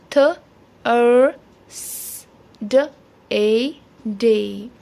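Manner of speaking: th, er, s, d, a, day as separate sounds, er drawn out; sounding out Thursday. Shown as a demonstration. Teacher repeats Thursday for phonics learners, sound by sound. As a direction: give each of th, er, s, d, a, day its own beat. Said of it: "U R makes er sound".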